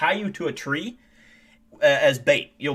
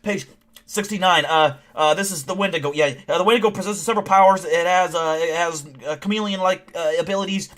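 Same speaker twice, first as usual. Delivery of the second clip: higher, louder flustered reading with stammers; nerdy scientist; 4 semitones higher, 5 dB louder than usual. Acted. Scientist flipping pages for the cryptid team, nerdy and flustered. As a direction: fumble through a file as a flustered nerd. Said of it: "the, the nerdy scientist guy".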